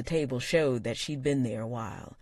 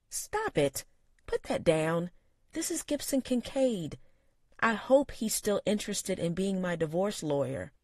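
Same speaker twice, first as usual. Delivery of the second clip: high scolding woman's voice; exasperated niece; 6 semitones higher than usual. A narrator's reading. Niece scolding her uncle, high and exasperated. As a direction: scold her uncle then introduce him.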